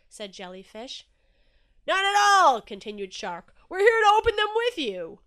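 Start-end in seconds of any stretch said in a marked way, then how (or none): 1.9-2.6 loud, high exclamation; big hearty shout; booming Shark. A narrator's reading. Shark reassuring Inky about the gifts, booming. 3.7-5.2 high, very loud declaration; booming Shark. A narrator's reading. Shark come to open presents with Inky, booming.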